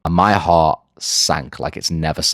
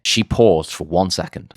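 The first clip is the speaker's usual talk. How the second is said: ordinary talk, nothing marked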